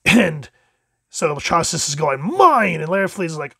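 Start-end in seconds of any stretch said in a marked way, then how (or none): 2.2-2.8 high, loud cry of possession; possessive outburst. Acted. Atrocitus claiming the lantern from Larfleeze, high possessive outburst. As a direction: claim it loudly, pitch jumping up.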